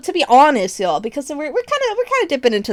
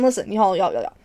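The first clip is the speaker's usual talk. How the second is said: ordinary talk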